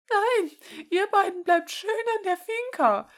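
tolerate no objection, grandma style; firm grandma voice. Acted. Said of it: "mit einem Unterton, der keine Widerrede duldete".

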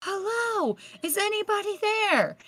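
high, far-off, softened call of "hello"; a light, hollow echo of calling into emptiness; mock calling out. Searching the empty hospital, lightly voicing her eerie call. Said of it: "screaming at the top of my lungs".

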